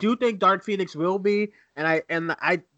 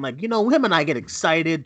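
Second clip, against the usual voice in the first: usual speaking voice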